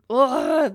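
loud, drawn-out ugh; disgusted groan. Real, not acted. Podcaster recoiling from a group that scares her, disgusted groan. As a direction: groan it out in revulsion.

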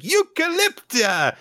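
very high, loud, drawn-out shout; stretched, squealed mock-childlike cheer; triumphant child. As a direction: imitate a kid proudly crowing it back wrong. Retold child's triumphant mistaken echo.

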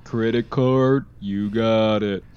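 slow, dragged tape-playback voice. Retold or imitated. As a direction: drag each word like a slowed recording. Repeats slowed Talkboy line.

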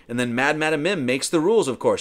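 normal talking voice

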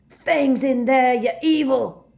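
slow, high, outraged bellow. A narrator's reading. Hagrid at the Death Eater burning his house, bellowing. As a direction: bellow it with full force. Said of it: "Hagrid bellowed".

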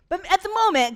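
usual speaking voice

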